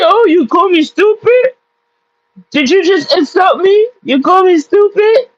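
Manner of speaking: high, whiny mock of her offended reply; mocking offended friend. Retelling the annoying friend's reaction in a high mocking whine. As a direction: throw her hurt squeal back at the room.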